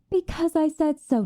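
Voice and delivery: high-pitched, impatient insistence; exasperated scolding. Acted. Vampire overruling a lover who won't feed, exasperated. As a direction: lay down the law, pitch jumping up.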